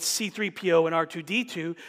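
ordinary delivery

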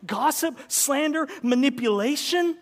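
loud, high preaching cry; full-force sermon shout; fiery preaching. Acted. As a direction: hammer each word at the crowd.

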